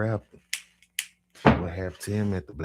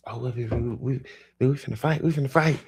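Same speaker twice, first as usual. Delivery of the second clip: hyped for a fight; 7 semitones higher, 5 dB louder than usual. Retold or imitated. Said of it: "He like".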